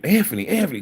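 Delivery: high sing-song calling of a name, imitating his wife; mimicking a wife calling Anthony repeatedly. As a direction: call his name like a wife from the other room.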